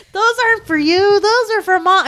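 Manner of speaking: high, loud telling-off; scolding. Retold or imitated. Older sister scolding the little one, high and loud. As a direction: snap at the kid that the gifts aren't hers. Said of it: "someone goes".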